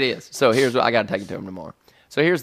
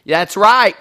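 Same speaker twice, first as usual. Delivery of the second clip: retold shouting; 6 dB louder than usual. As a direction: holler it into someone else's phone call. Shouting in at wife's phone call.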